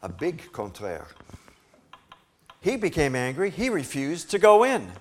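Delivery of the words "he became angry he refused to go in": loud, higher, heated telling of his refusal; angry narration. A narrator's reading. Preacher telling the congregation of the brother's anger, loud and heated. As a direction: let the anger burst into the telling.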